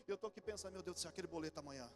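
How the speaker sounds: a hint of anxious inner voice; mock inner worry. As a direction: mutter it like a distracted worshipper. Distracted worshipper's inner thoughts.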